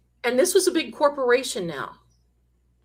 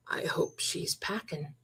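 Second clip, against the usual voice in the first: breathy, hushed whisper, much softer; 5 semitones lower, 13 dB quieter than usual. Retold or imitated. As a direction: lean in and whisper it like a secret. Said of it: "So you know what I ha-, said?".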